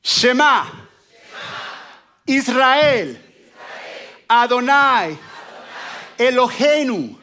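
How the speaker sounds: high, loud, slow proclaimed Shema with long pauses; loud call-and-response prayer; loud call to recite. Shown as a demonstration. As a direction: lead the crowd, calling each word out. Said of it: "Entonces dice".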